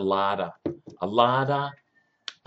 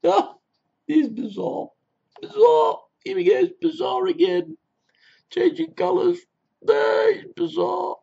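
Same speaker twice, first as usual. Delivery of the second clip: outraged squeal. Acted. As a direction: squeal it out like a scandalised old dear. Shrill outraged persona.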